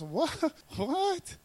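playful high-rising WHAT; mock disbelief with a laugh between; demonstrated "what". Shown as a demonstration. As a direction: toss out WHAT as an amused, incredulous question. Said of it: "I say it like this".

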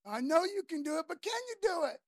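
high, urgent doubting question; acted plea edged with doubt; pleading challenge. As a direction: climb in pitch into a desperate question. Father's doubting challenge to Jesus.

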